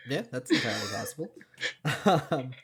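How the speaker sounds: normal talking voice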